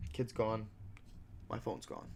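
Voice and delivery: ordinary talk, nothing marked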